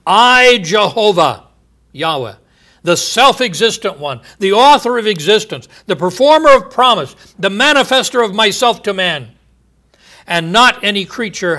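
"I" cried out high and loud, then rising praise; emphatic I. Shown as a demonstration. Preacher stressing God's "I" to the congregation, loud and high. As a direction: lift "I" high and build the titles. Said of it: "The word I is separately expressed and therefore emphatic".

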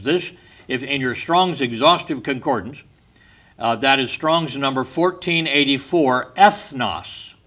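ordinary talk

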